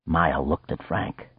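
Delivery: usual speaking voice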